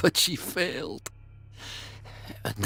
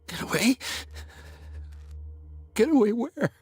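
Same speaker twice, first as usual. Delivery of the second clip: alarmed disbelief; 8 semitones higher than usual. Acted. Frightened prisoner questions escape offer.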